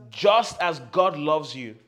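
loud and slow, each word weighted; bold, slow shout; loud slow declaration. Shown as a demonstration. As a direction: proclaim it boldly. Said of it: "As loud as you can".

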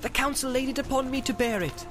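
distressed Frodo, pitched well up; distressed cry. A narrator's reading. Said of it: "cried Frodo".

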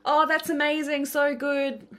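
high, loud, hollow "that's amazing" hiding envy; forced praise. Retold or imitated. Envious friend forcing praise, loud and high. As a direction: say "so good" brightly but grudging. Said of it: "coming from a place of".

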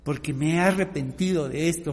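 normal talking voice

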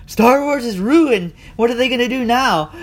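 mock panic. Mocking upset fans who think the franchise is over, in a high, loud mock wail. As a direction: ham up a fan's doom and gloom. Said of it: "they're thinking".

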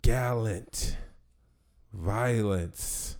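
slow, grand villain voice; dramatic villain intro. Acted. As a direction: announce yourself like a dark lord.

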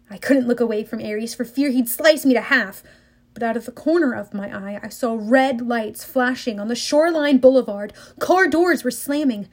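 plain reading voice

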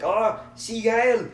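slow, high mock German accent, Schwarzenegger-like. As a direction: drag it out like Arnold in German-accented English. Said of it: "like, a German accent".

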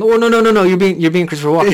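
loud, high excited call-out. Pretend. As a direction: shout it out, accusing. Calling out the alien.

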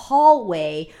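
normal talking voice

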